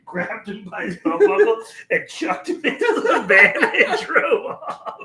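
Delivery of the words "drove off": normal talking voice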